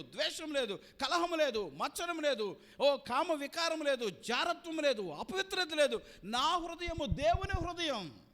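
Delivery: loud, ringing declaration from the pulpit; fervent sermon climax; preaching at full force. Acted.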